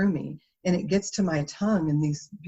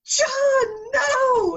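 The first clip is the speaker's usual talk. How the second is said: high, loud shocked protest; shocked scold; 6 dB louder than usual. Retold or imitated. Sister in the checkout line stopping her brother, shocked and loud. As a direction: cry out at her brother to stop.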